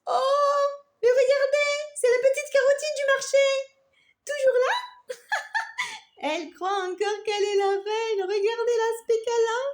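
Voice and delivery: high, loud, jeering and laughing; mocking. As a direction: taunt her with a cackle. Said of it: "La salade râleuse dit".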